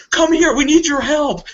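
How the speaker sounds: high, pleading call for help; a high, hammed-up call for help; mock urgent plea. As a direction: beg them to come over. Calling out to line Guardians.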